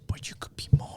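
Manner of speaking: whispered Galadriel tempting Sauron; breathy coaxing whisper, half-joking; mock whisper. Galadriel whispering temptation to Sauron, mock whisper.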